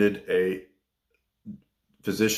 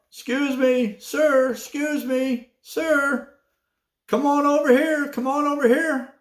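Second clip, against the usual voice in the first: mock calling across the room, much higher; imitating a lady shouting for him, pitched way up; retold lady calling out. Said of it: "I hear this lady yelling".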